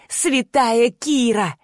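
drawn-out, sugary taunt; slowly mock with fake sweetness; sickly-sweet mockery. A narrator's reading. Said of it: "произнесла таким отвратительно слащавым голоском".

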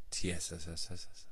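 very fast hissing S mic check; fizzing sound. Shown as a demonstration. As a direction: rattle off the hissing letters.

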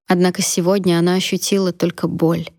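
ordinary delivery, nothing marked

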